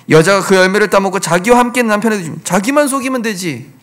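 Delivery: ordinary delivery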